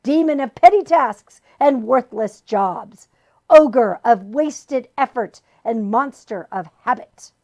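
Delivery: loud grand villain proclamation; booming demon boast; boastful demon. A narrator's reading. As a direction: announce yourself like a demon. Demon boasts his titles.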